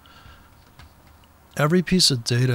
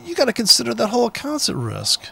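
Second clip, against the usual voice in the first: exasperated outburst; 11 semitones higher than usual. Real, not acted. Exasperated rant at traders.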